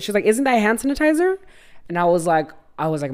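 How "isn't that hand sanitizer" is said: high-pitched innocent question; imitated clueless mom. Mom asks about the lube bottle, high puzzled imitation.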